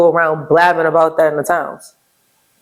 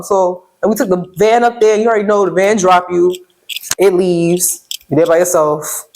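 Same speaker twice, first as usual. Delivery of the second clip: usual speaking voice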